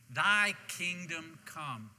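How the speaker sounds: very slow, spaced-out recitation; leading group recitation. Shown as a demonstration. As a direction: draw out each word so everyone can join in. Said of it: "Say that with me".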